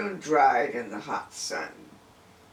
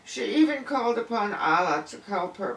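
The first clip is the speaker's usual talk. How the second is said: ordinary delivery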